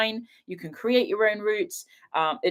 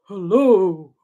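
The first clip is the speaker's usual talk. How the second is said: cat voice; 7 dB louder than usual. Pretend. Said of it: "How do cats talk?".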